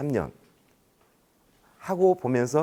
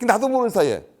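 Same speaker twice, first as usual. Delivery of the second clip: ordinary talk, nothing marked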